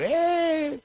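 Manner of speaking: mock a woman's long high holler; imitated yell. Woman yelling during the restitution message, imitated high yell. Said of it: "esa señora gritaba".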